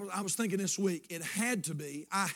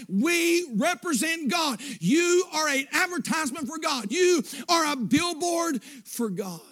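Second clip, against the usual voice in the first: rising, shouted sermon delivery; preaching at full force; 4 semitones higher than usual. Acted. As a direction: preach it, building to a roar on God. Preaching to the congregation.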